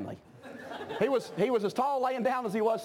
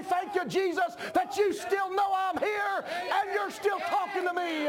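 praise prayer; 8 semitones higher than usual. Shown as a demonstration. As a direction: lift it up toward heaven, full voice. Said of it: "lift your head toward heaven and say".

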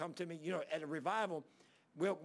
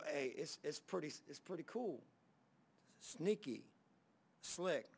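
usual speaking voice

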